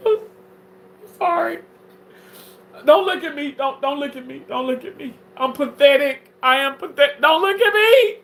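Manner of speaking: high hammed-up wailing plea; fake sobbing. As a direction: beg through fake tears. Mock sobbing breakdown.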